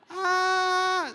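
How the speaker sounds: high long scream of someone falling. Shown as a demonstration. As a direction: scream as you drop off the cliff. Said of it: "sumigaw siya". (sigaw ng nahuhulog)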